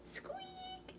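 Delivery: tiny high squeaky mouse noise; mouse squeak. Acted.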